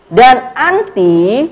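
"Dan anti" drawn out slowly for the class; slow teaching emphasis; slow demo. Shown as a demonstration. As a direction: stretch "anti" out so students catch it. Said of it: "kata anti ini dia berubah".

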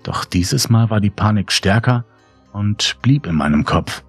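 plain reading voice

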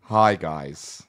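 Mike Pence impression. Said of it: "This is my Michael Pence".

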